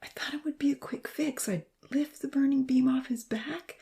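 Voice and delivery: high, hushed whisper, heavy with regret; dark murmur. A narrator's reading. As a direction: whisper it like a bitter confession. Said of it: "Darkly, he murmured".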